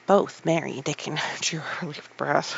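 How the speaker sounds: ordinary delivery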